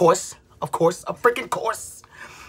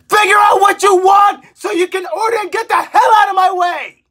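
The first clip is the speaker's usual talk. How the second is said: higher, louder furious demand; a real burst of rage at the customer ahead; angry shout; 6 semitones higher, 4 dB louder than usual. Real, not acted. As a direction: yell it at the person holding up the line. Ranting at slow customers.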